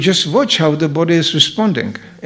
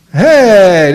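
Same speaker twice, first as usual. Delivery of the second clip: ordinary talk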